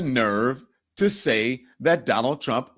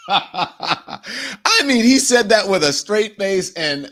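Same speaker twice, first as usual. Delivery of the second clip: talking through real laughter; words breaking into laughs; laughing; 6 semitones higher, 6 dB louder, much slower than usual. Real, not acted. Host laughing at what Vance said.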